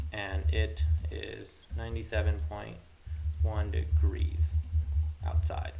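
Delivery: slow, loud, word by word; dictating while typing. Real, not acted. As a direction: spell it out at typing speed.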